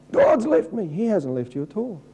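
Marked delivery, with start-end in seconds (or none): 0.1-0.9 mock wail. Mocking a believer's wail.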